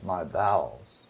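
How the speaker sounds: soft and slow, sinking low; deeply moved, hushed. Retold or imitated. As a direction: let it fall away quietly, as if moved from deep within. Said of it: "moved to the very depths of his being".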